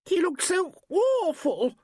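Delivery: very high, slow wail of horror; rising hysteria; hysterical. A narrator's reading. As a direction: wail it at the top of your pitch, dragging the words. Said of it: "voice rose hysterically".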